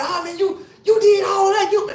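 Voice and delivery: excited bartender. Retold or imitated. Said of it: "the bartender was like".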